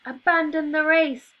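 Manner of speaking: high, loud panicked scream. Acted. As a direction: scream to flee. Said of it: "screamed the animals".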